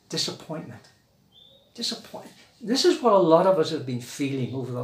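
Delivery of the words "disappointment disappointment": whispered disappointment. Acted. Repeated word fading to whisper.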